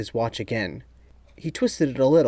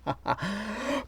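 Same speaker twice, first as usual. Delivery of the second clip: short, soft mocking chuckle; a sneering little laugh under the breath; quiet sarcastic laugh; 8 semitones higher, 10 dB quieter than usual. A narrator's reading. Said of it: "laughed in a quiet, sarcastic way".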